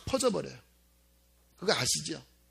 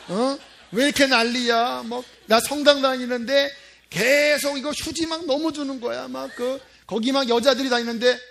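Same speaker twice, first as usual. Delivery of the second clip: lightly mimicked old woman's loud griping; grumbling grandmother; 5 semitones higher, 6 dB louder than usual. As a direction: imitate a grandmother complaining, pitch a bit up. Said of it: "어떤 한 할머니가". (투덜대는 할머니 흉내)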